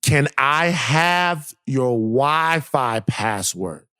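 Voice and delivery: each word stretched out slowly; slow drawn-out quote. Retold or imitated. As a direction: drag out the neighbor's ask word by word. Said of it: "is what she asked".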